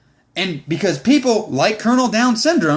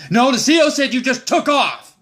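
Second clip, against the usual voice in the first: mock gunny argument; 6 semitones higher than usual. Gunny arguing, retold.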